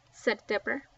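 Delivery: plain reading voice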